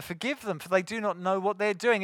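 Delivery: ordinary talk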